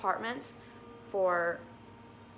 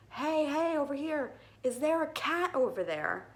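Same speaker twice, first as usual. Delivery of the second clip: high, raised calling-out voice; retold shout; 7 semitones higher than usual. Owner shouting down to a neighbor about the lost cat, retold shout. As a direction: call out as if across balconies. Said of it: "so I was shouting at him".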